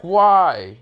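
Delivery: high, loud mock wail; crying wail. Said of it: "Mento was crying".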